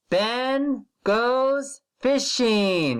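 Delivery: slow, drawn-out word-by-word reading; slow model reading. Shown as a demonstration. Teacher slowly modelling a sentence for first graders. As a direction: read it slowly so the children can follow. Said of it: "repeat after [name]".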